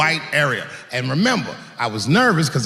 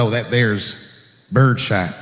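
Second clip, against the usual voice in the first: rural clerk; 8 semitones lower, slower than usual. Retold or imitated. Gun clerk voiced.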